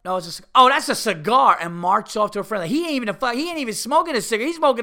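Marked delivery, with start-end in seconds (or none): 0.5-1.6 high, loud scoff; loud scornful brush-off. Retold or imitated. Rude woman scoffs loudly to her friend about the cigar. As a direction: shout the dismissal at the friend. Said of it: "She had no fucking manners".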